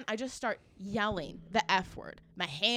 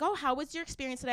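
higher, quick customer-service question; imitated lady clerk; 5 semitones higher, faster than usual. Cashier lady asks shopper about her visit, imitated perky question. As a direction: chirp it fast and high, as the clerk.

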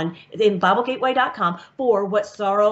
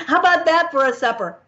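amused, higher-pitched delivery with real laughter; laughing; 6 semitones higher than usual. Real, not acted. Speaker jokes to audience about the marriage supper, laughing. Laughs at own grim joke.

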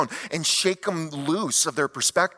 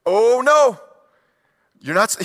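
loud, high, outraged busybody voice; the preacher hams up the Pharisees catching the disciples; mocking Pharisees; 7 semitones higher, 8 dB louder than usual. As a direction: squawk it like a scandalized rule-keeper. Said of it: "they pop up and they say".

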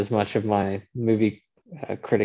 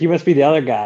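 imitating Wahlberg; 6 semitones higher, 7 dB louder, faster than usual. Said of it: "He's like".

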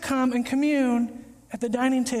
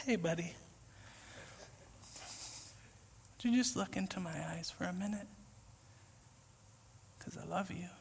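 much softer, low and gentle; tender reassurance; 5 semitones lower, 15 dB quieter, faster than usual. Retold or imitated. Jesus calming the anxious believer, soft and tender. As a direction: speak it like a loving friend.

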